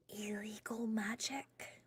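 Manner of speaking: slow hushed whisper. Real, not acted. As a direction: breathe it out, secretive. Secretive talk of illegal magic.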